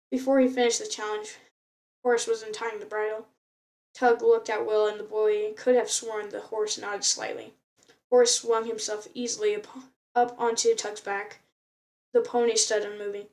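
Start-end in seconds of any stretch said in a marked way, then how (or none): none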